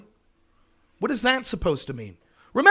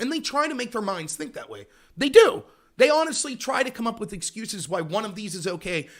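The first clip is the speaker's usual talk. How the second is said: ordinary talk, nothing marked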